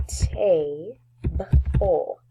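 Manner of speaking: "table" stretched into tay, b, ul with pauses; sounded out for spelling. Shown as a demonstration. Teacher spelling on the whiteboard, "table" drawn out sound by sound. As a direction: sound out "table" slowly, piece by piece. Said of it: "practice spelling some words".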